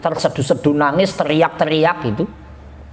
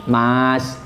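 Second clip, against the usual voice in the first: gentle condolence; 6 semitones lower, slower than usual. Acted. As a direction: console a grieving friend gently. Condolence to a bereaved friend. (melayat teman yang berduka, pelan dan lembut)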